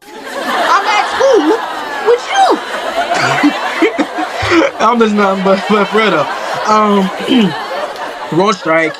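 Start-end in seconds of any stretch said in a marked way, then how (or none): 0.0-2.6 high, giggly squeal through laughter; laughing. Real, not acted. Joking about first day back. 2.6-4.8 unvoiced giggling laugh; breathy laugh. Real, not acted. Laughs after school joke.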